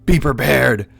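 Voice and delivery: rally them to prepare; panicked elf commander; alarmed shout. Acted. Alarm as enemies close in.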